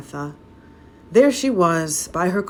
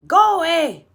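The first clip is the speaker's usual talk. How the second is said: put-on much higher, loud old hag cry; cried out; 14 semitones higher, 7 dB louder than usual. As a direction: cry out to drive them off. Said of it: "she cried".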